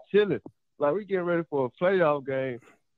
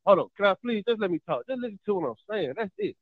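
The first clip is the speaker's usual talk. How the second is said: normal talking voice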